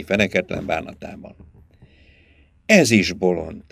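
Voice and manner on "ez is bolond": usual speaking voice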